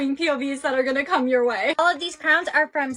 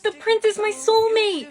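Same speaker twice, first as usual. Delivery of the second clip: high, loud, thrilled exclamation; loud and excited; 9 semitones higher, 6 dB louder than usual. Acted. Girl telling friend the prince is her soulmate, loud excitement. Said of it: "Don't say that so loud".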